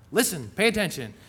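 normal talking voice

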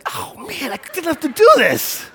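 high-pitched comic wail, climbing in volume; mock anguished wail. Preacher acting out his needless apology, comic anguished wail. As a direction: cry it out in exaggerated exasperation.

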